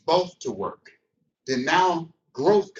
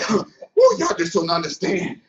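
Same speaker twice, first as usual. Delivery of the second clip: imitating preacher; 3 semitones higher, 7 dB louder than usual. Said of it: "You're like".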